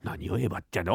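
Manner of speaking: ordinary delivery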